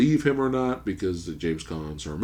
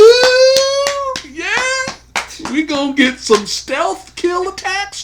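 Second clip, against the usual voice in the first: much higher, louder, slow hyped-up cheer; hyped whoop; 9 dB louder, slower than usual. Retold or imitated. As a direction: whoop like a fan who can't wait. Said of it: "So I'm like".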